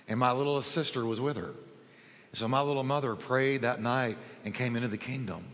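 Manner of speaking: ordinary talk, nothing marked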